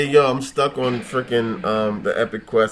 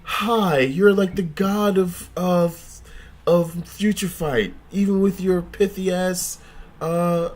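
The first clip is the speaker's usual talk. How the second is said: high, gushing fan voice; mock worship; 6 semitones higher, slower than usual. Mock worship of a gamer, high and gushing. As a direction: play a fawning fan greeting a hero. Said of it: "Why can't you just be like".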